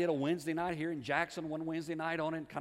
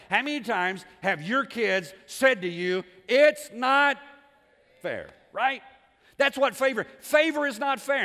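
loud, high, punched-out words; full-force preaching; 6 semitones higher, 11 dB louder, slower than usual. Real, not acted. Preacher challenges parents on fairness, loudly emphatic. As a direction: hammer it home to the crowd, voice raised.

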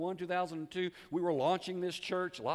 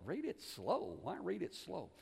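softer, questioning echo; puzzled inner thought; 7 dB quieter, slower than usual. Retold or imitated. Preacher puzzles over God's instruction to himself, softly questioning. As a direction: wonder it to yourself, dropping the volume. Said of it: "And I thought".

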